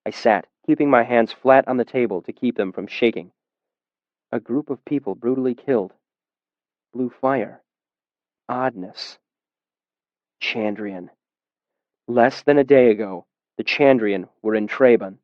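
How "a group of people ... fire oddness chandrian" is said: hushed, dropped, half to himself; stunned inner thought. A narrator's reading. Narrator realizing the Chandrian struck, hushed and stunned. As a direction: let each fragment sink in quietly.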